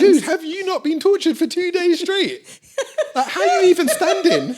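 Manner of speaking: high, loud incredulous laugh-talk; laughing disbelief. Retold or imitated. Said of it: "And I'm like".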